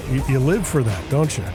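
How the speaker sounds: usual speaking voice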